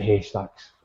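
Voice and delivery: ordinary talk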